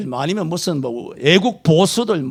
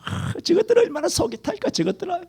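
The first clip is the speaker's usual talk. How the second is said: high, soft sneering voice of gloating enemies; mock gloating taunt; 11 semitones higher, 7 dB quieter than usual. Preacher imitating communists gloating over Christians, mock sneering. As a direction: sneer it like a smug enemy.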